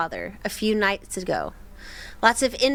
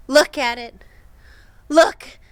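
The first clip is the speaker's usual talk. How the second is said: urgent call; 3 semitones higher, 7 dB louder than usual. Retold or imitated. Heard voice quoted.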